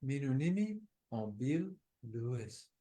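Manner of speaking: whispered name, very soft. Shown as a demonstration. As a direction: breathe the name out quietly. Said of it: "Bill, whisper".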